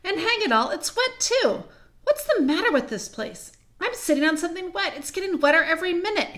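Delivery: high-pitched, agitated grumbling; exasperated complaint. Acted. Peter finds he is sitting on something wet, exasperated.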